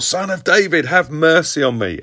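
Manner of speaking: half-shouted imitation of a plea; mock shout. Preacher re-enacting the beggar's desperation, half shouting. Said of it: "he shouts all the more".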